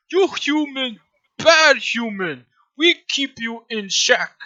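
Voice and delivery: high, loud, slow brute voice; menacing simple creature. Acted. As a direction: scold slowly like a dim-witted guard. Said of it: "Just like".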